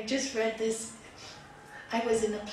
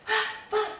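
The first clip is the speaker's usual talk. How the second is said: high, helpless stammer; storyteller's flustered husband; stammering protest; 12 semitones higher, 4 dB louder than usual. Acted. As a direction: sputter a high-voiced objection.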